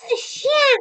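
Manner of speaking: high, loud sudden cry; the wife crying out a quick excuse. A narrator's reading. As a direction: cry it out to end the question. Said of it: "cria-t-elle".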